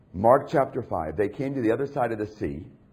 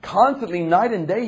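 ordinary delivery

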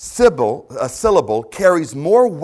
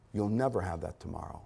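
the repeat dropped much softer, almost under the breath; hushed echo; 8 semitones lower, 12 dB quieter than usual. Shown as a demonstration. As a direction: let the repeat fall away to a hush.

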